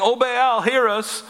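mock cry to an idol; a hint of desperate chanting; pleading call.

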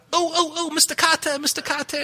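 high, strained eager student voice; nasal-free but squeaky keen pupil; Arnold Horshack. Retold or imitated. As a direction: wave your hand and plead to the teacher. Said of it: "Remember Arnold Horshack in Welcome Back Kotter".

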